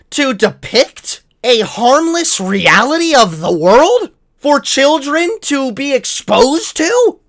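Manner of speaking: mock outrage. Mocking conservatives in a hammed-up outraged rant.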